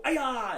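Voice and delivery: sharp, high, loud kiai; martial arts kiai yell. Shown as a demonstration. Martial arts teacher shows students what people yell, loud kiai. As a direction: let out a quick loud kiai shout. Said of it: "make those really loud key ups".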